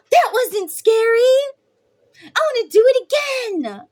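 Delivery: high, loud thrilled squeal after the slide; excited kid. Retold or imitated. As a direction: shriek with delight like a little boy.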